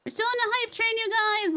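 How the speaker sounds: high, excited hype call; hyped up. Real, not acted. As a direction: cheer the chat on. Celebrating the hype train on stream.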